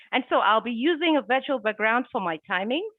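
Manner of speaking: ordinary talk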